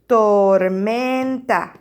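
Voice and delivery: "Tormenta" stretched very slowly and loud; syllable by syllable. Shown as a demonstration. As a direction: draw out each syllable of "Tormenta". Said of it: "¿Cuántas sílabas?".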